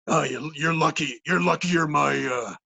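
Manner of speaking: cartoon dog voice. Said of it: "I said something stupid like".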